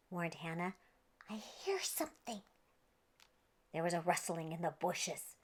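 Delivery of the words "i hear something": soft hushed whisper; a wary, hushed warning. Acted. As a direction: whisper it so nothing hears you. Hannah warns the crew.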